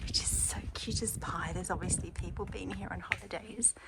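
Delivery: soft hushed whisper all the way through. Real, not acted. Showing viewers the back courtyard of the new house, hushed whisper. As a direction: keep it down so the neighbours don't hear. Touring courtyard, neighbours nearby.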